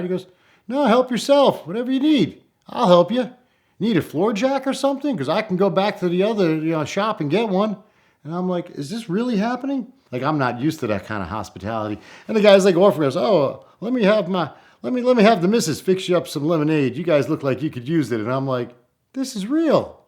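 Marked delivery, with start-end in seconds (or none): 0.7-7.8 much higher, louder folksy farmer voice; hospitable farmer. Retold or imitated. Farmer offers help warmly. 8.7-9.9 disbelief. Retold or imitated. Disbelief at hospitality. 13.2-18.2 high, loud folksy farmer offering lemonade; kindly country host, eager to help; hospitable farmer. Retold or imitated. 19.1-20.0 amazed. Retold or imitated. Amazed aside.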